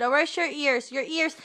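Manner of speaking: ordinary talk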